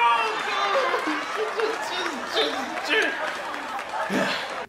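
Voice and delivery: long, high mock cackle; hammed-up laughing fit. Reviewer parodies a comic after his punchline, drawn-out fake laughing fit. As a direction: laugh far too long at your own joke.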